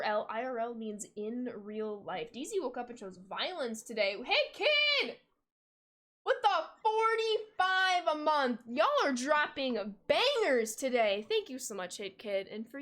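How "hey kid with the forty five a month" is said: excited shout-out. Real, not acted. Streamer hyping a long resub.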